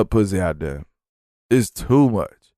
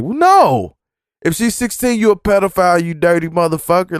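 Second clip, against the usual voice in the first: exasperated retort; 7 semitones higher than usual. Retold or imitated. Scolding coworker about teen girl.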